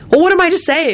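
high, exasperated question; indignant rhetorical question; angry thought. Acted. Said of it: "Elizabeth thought angrily".

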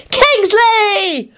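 very high, drawn-out squeal of a name; delighted child's shriek. A narrator's reading. Teddy greets Kingsley from the den, shrieking. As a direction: squeal it high like a thrilled little kid. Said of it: "Teddy shrieked from the den".

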